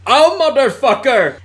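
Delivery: high, loud startled curse; hammed-up scammer swearing; mock scammer curse. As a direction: yelp it like a scammer caught off guard. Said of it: "I heard him say".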